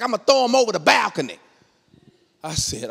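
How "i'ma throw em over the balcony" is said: high, quick mock threat; taunting threat. Wife threatening to toss husband's keys, taunting. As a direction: fire the threat back fast.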